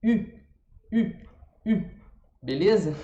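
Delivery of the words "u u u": higher U with rounded lips; U held with the tongue high; French U. Shown as a demonstration. Teacher demonstrating the French U sound, higher. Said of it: "a língua tá subindo ou descendo".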